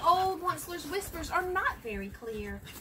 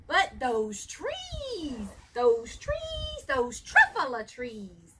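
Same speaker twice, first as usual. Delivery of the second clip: awed; 4 dB louder, much slower than usual. Acted. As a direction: savour each trees like a treasure. Onceler awed by trees.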